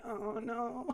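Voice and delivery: a mother dreading the hug; dismayed aside. Acted.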